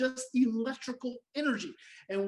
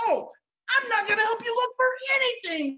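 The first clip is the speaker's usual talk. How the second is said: mock defiance; 11 semitones higher, 3 dB louder than usual. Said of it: "You drop into behaviors".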